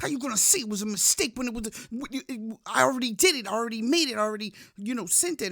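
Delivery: high, loud and fast angry rant; mean outburst. Retold or imitated. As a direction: snap back at your sister in a fast, loud rant. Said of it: "I got mean".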